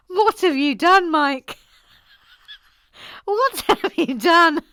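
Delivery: high, loud dismay; mock-horrified reproach. Host blaming Mike for the accent fiasco, loud dismay. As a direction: wail it at the culprit. Mock horror at Mike's request.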